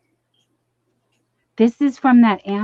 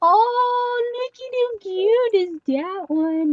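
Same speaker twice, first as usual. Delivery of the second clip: excited squeal; 11 semitones higher, slower than usual. Real, not acted. Gasping at a cute item.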